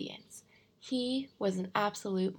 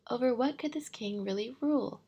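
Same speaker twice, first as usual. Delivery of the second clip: usual speaking voice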